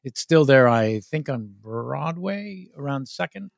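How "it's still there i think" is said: ordinary talk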